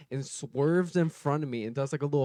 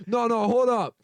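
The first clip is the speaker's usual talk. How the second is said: higher, louder hold up; mock threat; 8 semitones higher, 5 dB louder than usual. Imitating the angry driver getting out, higher and louder. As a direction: stop him threateningly. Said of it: "And he goes".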